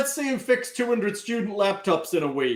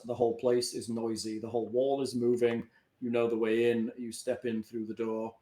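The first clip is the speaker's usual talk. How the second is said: scene narration; 6 semitones lower, 5 dB quieter than usual. A narrator's reading. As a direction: set the scene for the players. GM sets the scene.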